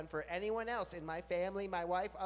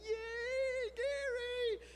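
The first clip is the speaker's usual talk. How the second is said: much higher squeal of delight; overjoyed; much slower than usual. Retold or imitated. Greeting the neighbors' dog Gary, much higher squeal of joy. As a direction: burst with glee at the dog. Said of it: "And I'd just be like".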